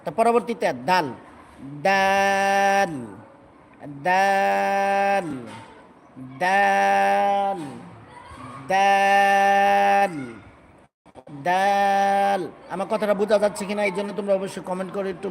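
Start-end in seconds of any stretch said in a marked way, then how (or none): 1.8-12.5 slow chanted letter name দাল; long drawn-out dal. Shown as a demonstration. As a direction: stretch দাল into one long held note. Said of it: "বলো, বলো".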